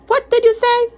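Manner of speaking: very high, outraged question; scandalized, voice shooting up; affronted challenge. Acted. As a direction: squawk in disbelief at the accusation. Affronted in the quarrel.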